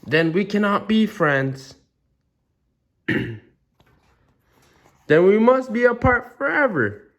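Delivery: high, rising wail of heartbreak; melodramatic despair. Acted. Gerald despairs over losing his friend.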